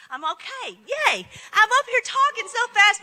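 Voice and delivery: normal talking voice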